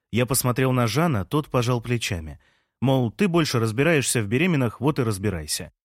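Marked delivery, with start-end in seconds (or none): none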